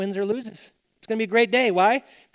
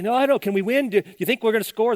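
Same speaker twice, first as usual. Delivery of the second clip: anxious teammates; 6 semitones higher, 4 dB louder than usual. Retold or imitated. Panicky teammates in huddle.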